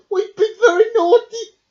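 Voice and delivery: high, loud worried cry; anxious cry. Acted. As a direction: panic about being caught. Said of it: "cried Hedgehog".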